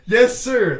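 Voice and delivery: loud, high laughing yes sir; laughing cheer. Real, not acted. Laughing cheer at right guess.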